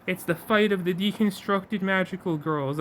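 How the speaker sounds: ordinary delivery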